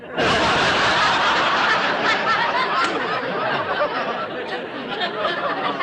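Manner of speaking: a long, loud breathy laugh. Acted. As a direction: laugh it off at length after the blunder. Miss Brooks laughs at herself.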